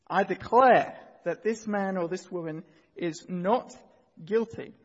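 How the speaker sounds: judge's ringing, loud proclamation; booming authoritative judge. Acted. Judge repeating the verdict to the courtroom, loud and authoritative. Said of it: "he says in his loud, booming, authoritative voice".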